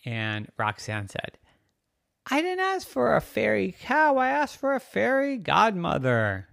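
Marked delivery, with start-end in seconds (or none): 2.3-6.5 put-on high, loud complaining kid voice; high-pitched childish objection; indignant girl. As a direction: protest like a put-out little girl. Girl protests to the fairy cow.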